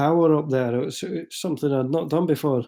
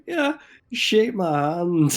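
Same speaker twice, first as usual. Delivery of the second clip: mocking offer; 4 semitones higher, much slower than usual.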